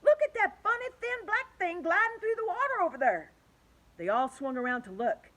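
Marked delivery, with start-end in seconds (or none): 0.0-3.3 high, loud, eager pointing voice; keen, raised voice spotting something odd; excited centipede. A narrator's reading. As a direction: call the others to look. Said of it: "cried the centipede".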